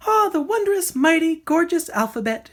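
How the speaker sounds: high, wide-eyed bird voice; marveling bird. A narrator's reading. The bird marveling at her banner, high and awed. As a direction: marvel at the alphabet like a delighted songbird. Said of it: "she marveled".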